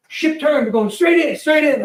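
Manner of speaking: high, loud urgent call over the engines; shouted call-out. Retold or imitated. Pilot shouting landing news to passenger, loud and high. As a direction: bark the news over the roar.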